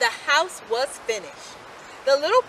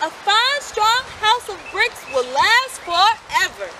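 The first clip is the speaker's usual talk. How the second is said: higher, louder proud builder; 8 semitones higher, 5 dB louder than usual. A narrator's reading. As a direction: praise the bricks. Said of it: "replied the builder".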